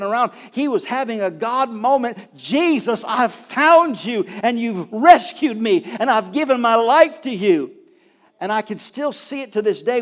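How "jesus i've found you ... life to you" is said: loud, slow, pleading cry to Jesus; fervent prayer. Retold or imitated. A weeping man at the altar surrenders his life, in a loud slow plea. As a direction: pour it out like a man finally rescued.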